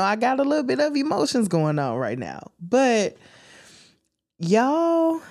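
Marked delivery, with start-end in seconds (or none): none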